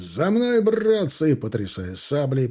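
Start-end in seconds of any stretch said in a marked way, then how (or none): 0.0-1.3 very high, summoning call; rally the men, pitching the call high; rallying shout. A narrator's reading. Officer calls his men to follow, rallying cry. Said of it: "прокричал он призывно".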